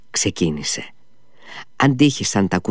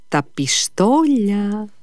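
slow, higher Τα πιστόλια; slow insistence; 11 semitones higher, much slower than usual. Acted. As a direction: drag it out as a demand. Ζητά τα πιστόλια. (αργά, πιο ψηλά)

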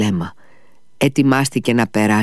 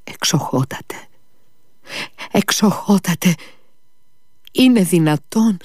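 high, desperate pleading cry; a poor father begging the doctor, frightened; 8 semitones higher than usual. A narrator's reading. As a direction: beg the doctor in terror. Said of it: "το βλέμμα του τρομαγμένο".

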